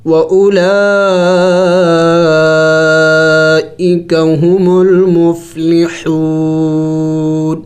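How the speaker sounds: drawn-out, melodic tajweed reading; slow Quran recitation. Shown as a demonstration. Teacher recites the joined verse to students, slow and melodic. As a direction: chant each word long and joined. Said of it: "ملا کے پڑھتے ہیں".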